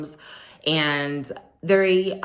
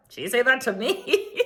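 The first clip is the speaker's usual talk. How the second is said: laughing disbelief; 6 semitones higher than usual. Retold or imitated.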